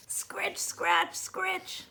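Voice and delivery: soft whispered scratchy sound effect; whispered scratching. A narrator's reading. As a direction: breathe the scratches out quietly. Pen scratching paper.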